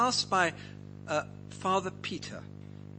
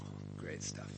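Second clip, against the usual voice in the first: very low, soft aside; retold private thought; 15 dB quieter than usual. Preacher recalling his reaction to her praise, low murmured thought. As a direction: drop to a low murmur, as if thinking to yourself. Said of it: "And I thought".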